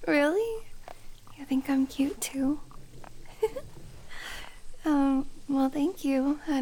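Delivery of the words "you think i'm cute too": soft bashful whisper, close to the ear; shy whisper. Acted. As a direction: confess it in a hushed whisper. Flattered whisper.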